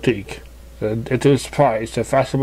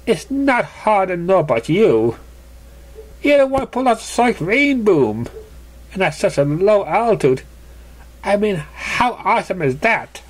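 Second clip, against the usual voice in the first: lightly voiced Surprise; 6 semitones higher than usual. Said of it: "Surprise replied".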